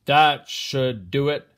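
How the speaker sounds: slow, loud and pleased with himself; satisfied farmer. Acted. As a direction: declare it done, slow and loud. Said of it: "said the farmer".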